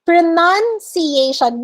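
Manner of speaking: Pronunciation said slowly as "pro-NUN-see-ay-shun"; correct pronunciation demo. Shown as a demonstration. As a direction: say Pronunciation with nun, not noun. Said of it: "Be mindful of this one".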